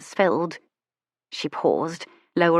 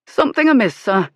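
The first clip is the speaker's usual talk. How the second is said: higher, louder servant's voice; a concerned coachman asking his master; driver's voice; 4 semitones higher, 6 dB louder than usual. Acted. Kay the driver speaks.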